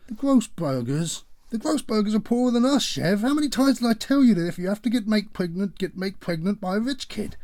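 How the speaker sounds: high, loud, fast rant; father ranting at his daughter about the boyfriend; outraged disbelief. Acted.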